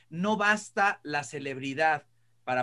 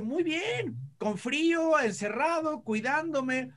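quoting late friend; 11 semitones higher, slower than usual. Retold or imitated. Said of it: "y me dice".

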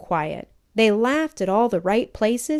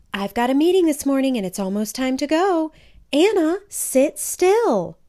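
hurried mom; 7 semitones higher, 5 dB louder than usual. A narrator's reading.

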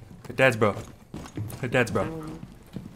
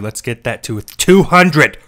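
hype; 8 dB louder, slower than usual. Real, not acted. Hyping stream chat.

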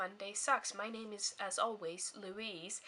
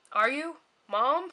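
loud, high calling up the house; 5 semitones higher, 10 dB louder, slower than usual. Real, not acted. Girl calling out to her mom, loud and high. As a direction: call out to check on mom.

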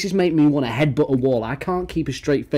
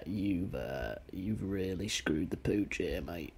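hushed, slow confession; sheepish whisper; 6 semitones lower, 16 dB quieter, slower than usual. Real, not acted. Streamer whispering an apology to the kid in the video.

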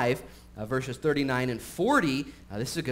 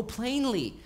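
plainly said much higher with a falling oh; mock surprised plainly; 11 semitones higher than usual. Preacher as Jesus feigning surprise at plainly. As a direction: feign sudden understanding. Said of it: "tell us, uh, plainly".

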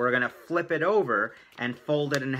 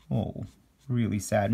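mock sad; 3 semitones lower, 4 dB quieter than usual. Pities the sad drawn face.

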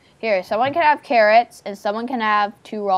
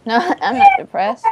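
laughing denial; 10 semitones higher, 6 dB louder than usual. Real, not acted. Denies being depressed, laughing.

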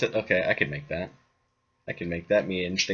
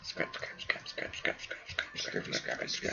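rapid whispered chant; whispering; 12 semitones lower, 17 dB quieter than usual. Real, not acted. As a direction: whisper it under your breath. Muttering while collecting scrap.

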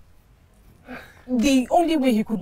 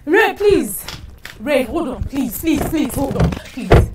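loud, higher, urgent begging; woman begging her fiancé not to leave; frantic pleading; 5 semitones higher, 6 dB louder, slower than usual. Acted. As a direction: call after him desperately. Begging Ray to hold on.

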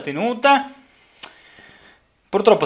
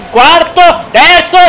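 much higher, louder rising cheer; excited counting up; 9 semitones higher, 7 dB louder, much slower than usual. Real, not acted. Gamer overtaking rivals, excited count. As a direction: call out each place as you climb. Climbing positions.

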